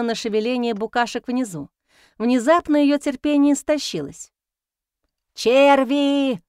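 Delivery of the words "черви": loud booming bellow; thunderous yell. A narrator's reading. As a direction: roar it down from the roof. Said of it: "громоподобно заорала она".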